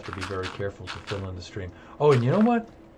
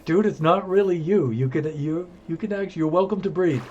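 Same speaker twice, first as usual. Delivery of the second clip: higher, louder mock scolding of yourself, "dude"; mock pep talk; 9 semitones higher, 7 dB louder than usual. Player telling himself he can breathe, higher mock scolding. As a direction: talk yourself down in a raised, amused voice. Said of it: "you're like".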